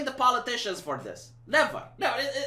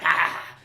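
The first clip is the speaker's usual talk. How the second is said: crazy laugh; 4 dB louder than usual. Shown as a demonstration. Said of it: "he doesn't do the crazy".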